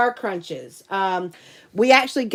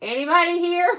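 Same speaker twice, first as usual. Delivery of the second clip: higher, eerie horror-movie call; spooky call; 7 semitones higher than usual. Pretend. Alone at night, calling out in mock horror-movie fear. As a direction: call into the dark house.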